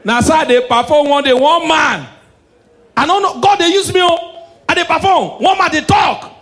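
high frantic comic yell; high excited yelling. Acted. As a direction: yell it like a frantic church show. Comic acting out church scene.

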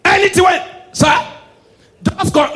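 high, loud, slow shouted callback; loud high repeated punchline; laughing shout. Real, not acted. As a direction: bellow the callback. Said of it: "repeats punchline, laughing".

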